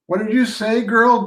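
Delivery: high, loud, suspicious bark; loud demand. Acted. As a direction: snap the question at her, high and loud. Deef demands what she muttered.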